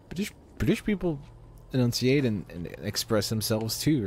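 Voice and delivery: ordinary talk, nothing marked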